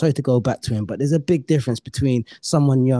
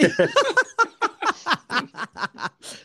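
high burst of laughter. Real, not acted. Laughing at the mispronunciation.

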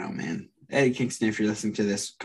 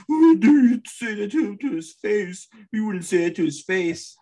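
much higher, louder indignant fan; mock angry fan; 10 semitones higher, 6 dB louder than usual. Co-host imitating a fan defending the wrestler, high shrieking. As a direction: screech like an outraged fanboy. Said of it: "some jabroni's gonna be like".

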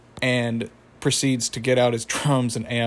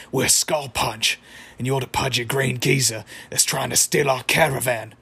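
put-on Australian accent. Band leader confronting the van thief, gargly imitation Australian accent. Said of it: "in an Australian accent".

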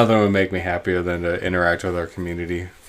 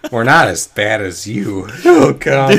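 laughing; slower than usual. Real, not acted. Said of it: "I'm still crying".

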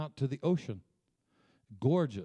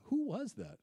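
wife puzzled; 11 semitones higher than usual. Retold or imitated. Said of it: "my wife's like".

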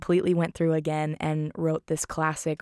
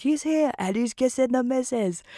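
high, louder put-on policeman voice; imitated constable; 6 semitones higher, 4 dB louder than usual. Constable reports the husband's return, high imitated announcement. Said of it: "the constable has some, some really great lines".

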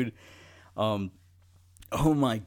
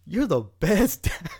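put-on kid voice; 8 semitones higher, 4 dB louder, slower than usual.

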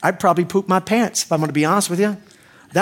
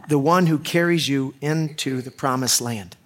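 usual speaking voice

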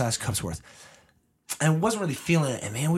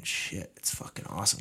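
hushed relish; 7 semitones lower, 7 dB quieter than usual. Real, not acted. Savoring hangover poutine.

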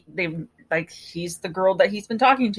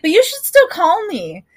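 high, louder sing-song flirt; 9 semitones higher, 6 dB louder than usual. Retold or imitated. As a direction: toss it off like a breezy flirt, pitched high.

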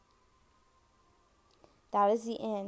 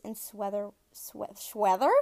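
tired words slurring together; 4 dB louder than usual. Real, not acted. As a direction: stumble over the word sweater. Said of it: "my words are just slurring together".

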